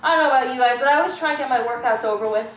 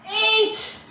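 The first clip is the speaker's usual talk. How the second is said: strained high count; 8 semitones higher than usual. Real, not acted. Straining through a hard rep.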